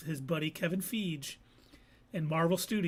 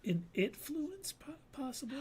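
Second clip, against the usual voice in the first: higher, much softer teasing pun, trailing into a whisper; sly pun; 8 semitones higher, 8 dB quieter than usual. Acted. Host teases co-host with a spooky pun, whispered. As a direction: slip in the pun conspiratorially. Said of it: "pun delivered softly".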